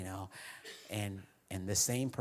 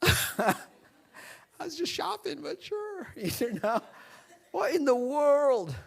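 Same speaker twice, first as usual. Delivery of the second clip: much higher, laughing, overwhelmed; stunned disbelief; 7 dB louder, slower than usual. Retold or imitated. Pastor stunned by the friend's words in the store, high and laughing. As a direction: squeak out the shock through laughter.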